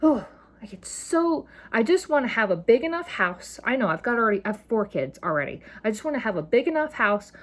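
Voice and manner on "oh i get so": unvoiced breathy whisper; whispered aside. Real, not acted. Vlogger overcome by emotion, whispering to herself. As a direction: let the feeling escape in a whisper.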